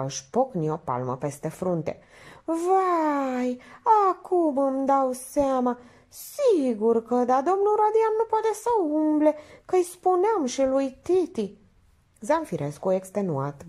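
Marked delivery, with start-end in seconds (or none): 2.4-11.6 high, drawn-out dawning realisation; exclaim, then chatter on; naive Minerva enlightened. Acted. Minerva realising Radian cannot walk about, chattering.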